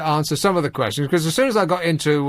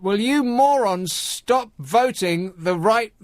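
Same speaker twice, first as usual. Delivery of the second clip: higher-pitched, slow exasperated plea; indignant; 4 semitones higher, slower than usual. Real, not acted. Host scolding Big Brother voters, slow and indignant. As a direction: spell it out slowly in indignation.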